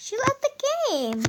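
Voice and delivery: high, loud surprised cry. Real, not acted. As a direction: blurt it out in shock. Sister suddenly leaves the game.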